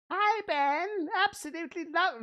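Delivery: high, shrieky witch impression; witch's voice. Host reading Laura's letter aloud, as a high witch. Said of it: "a witch's voice".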